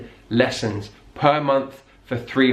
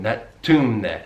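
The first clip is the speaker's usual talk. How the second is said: normal talking voice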